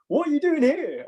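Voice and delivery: very high-pitched mock astonishment; imitated amazed fan. Fan spotting an actor in a club, mock amazement. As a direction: play a fan who can't believe it.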